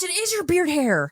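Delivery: high, startled realization; suddenly discovering something gross; surprised. Real, not acted. Finds beard hair in mouth.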